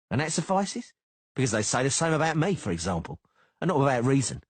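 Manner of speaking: taunting sneering bodyguard; Cicada voice. Acted. Cicada goads Geralt.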